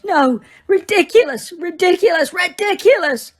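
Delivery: high, loud, frantic repeated cries; hysterical, breaking down; desperate sobbing cries. A narrator's reading. Mrs Weasley frantically repeats the spell, high and loud.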